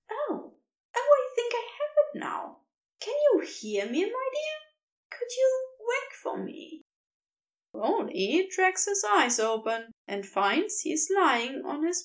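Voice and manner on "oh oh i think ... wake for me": higher, gentle coaxing creature voice; warm and fond. A narrator's reading. Creature coaxing Crowley awake, warm and fond higher voice. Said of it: "warm and fond in his ear".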